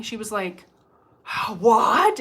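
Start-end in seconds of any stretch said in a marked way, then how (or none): 1.6-2.2 sudden loud cry of disbelief; shocked what. Retold or imitated. Client hearing her mom's ring named, shocked loud cry. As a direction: blurt it out, stunned.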